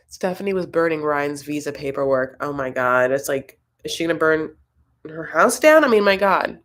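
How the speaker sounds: normal talking voice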